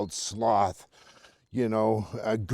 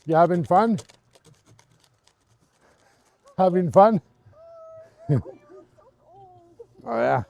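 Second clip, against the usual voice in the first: doggy talk; 7 semitones higher, 6 dB louder than usual. Real, not acted. Talking to a passing dog.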